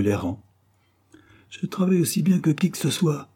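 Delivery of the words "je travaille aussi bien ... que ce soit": storyteller's raised-pitch outcry; narrated cry. A narrator's reading. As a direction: defend yourself hotly but contained. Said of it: "cria-t-il".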